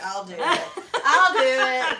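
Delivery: loud, high mock-eager volunteering, laughing; hammed-up excited imitation; imitated eagerness. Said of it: "She's like".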